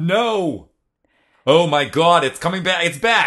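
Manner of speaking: high, laughing Long Island accent. Shown as a demonstration. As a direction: let the Long Island accent burst back. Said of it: "My accent's back".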